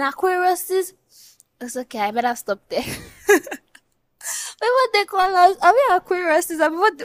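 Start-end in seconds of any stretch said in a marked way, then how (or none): none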